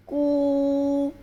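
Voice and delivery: कू with the oo held long and level; drawn-out कू. Shown as a demonstration. Teacher shows children how to pronounce it, drawn-out कू. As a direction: sustain the vowel of कू steadily. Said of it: "अब इसको हम कैसे pronounce करेंगे?".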